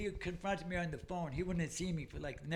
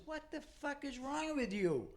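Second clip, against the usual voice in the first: imitated scolding father; 8 semitones higher, 4 dB quieter than usual. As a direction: lightly act out an appalled dad. Father confronts son in retold scene.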